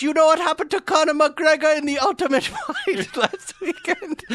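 high, loud mock Middle Eastern scammer; angry Middle Eastern accent. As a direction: menace them in a put-on Middle Eastern accent. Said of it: "angry Middle Eastern guys".

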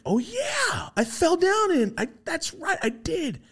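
very high, dawning "oh yeah"; sudden realization. Retold or imitated. Recalling his fall to the beings, high surprised realization.

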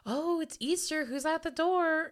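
high, drawn-out impression of a girl; imitating video girl. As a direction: put on a high girly quote voice. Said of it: "She was like".

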